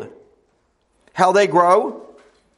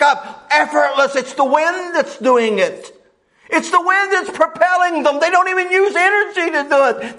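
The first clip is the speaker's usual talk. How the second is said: rising, higher preaching that swells at the end; 7 semitones higher than usual. Acted. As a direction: lift the congregation with the image of soaring. Preacher builds to full force.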